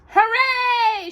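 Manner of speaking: high, loud cheer; shouted hooray. Acted. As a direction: cheer it out like a crowd. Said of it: "shouted the people".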